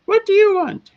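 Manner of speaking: very high, piercing little voice; high-pitched voice. Acted. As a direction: squeak it out high like a hidden girl. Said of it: "you hear the high-pitched voice go".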